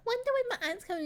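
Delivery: put-on a tiny hopeful girl's voice; little girl voice. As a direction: play the innocent little girl. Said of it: "she's like".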